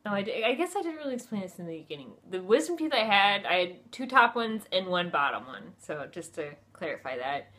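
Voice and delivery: ordinary talk, nothing marked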